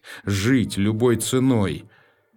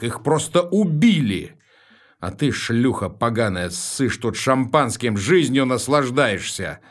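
loud, raised, furious shouting; righteous fury; 5 semitones higher, 5 dB louder than usual. A narrator's reading. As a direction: rage at her for the dead. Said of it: "праведном гневе". (праведный гнев)

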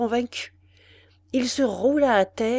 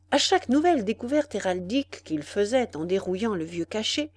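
ordinary delivery

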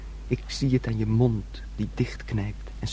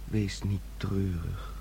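tender whisper; 3 semitones lower, 9 dB quieter than usual. Acted. Son tenderly comforting his sister.

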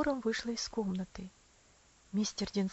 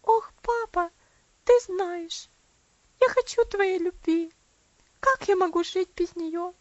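put-on pleading little girl; 8 semitones higher, 8 dB louder than usual. A narrator's reading.

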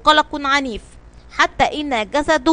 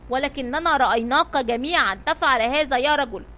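ordinary delivery, nothing marked

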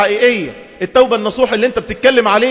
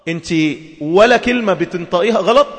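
ordinary talk

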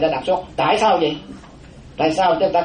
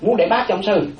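ordinary talk

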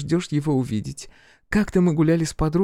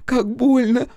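high, babbling cry of pain; frightened little-girl voice; 6 semitones higher, slower than usual. A narrator's reading. Fiona has stumbled and cries out to Crow, frightened little-girl voice. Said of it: "пролепетала она испуганным голосом маленькой девочки".

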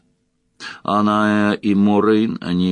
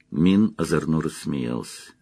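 plain reading voice

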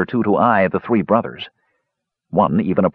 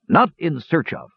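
higher-pitched, emphatic correction; correcting; 7 semitones higher than usual. A narrator's reading. Dwarf captain correcting the young mage, emphatic. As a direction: set him straight firmly. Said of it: "Dugan corrected".

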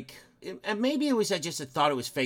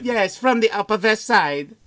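higher, louder mock Eastern European accent; mimicked Carpathian accent; 4 semitones higher, 6 dB louder than usual. Echoing a movie henchman to the co-host, Carpathian accent. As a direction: agree eagerly in a hammy Eastern European accent. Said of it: "the Carpathian's assistant".

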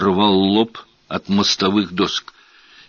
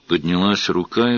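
ordinary delivery

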